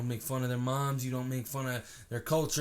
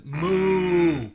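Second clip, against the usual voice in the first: cow call; 5 semitones higher, 8 dB louder than usual. Retold or imitated. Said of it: "you stand by the fence".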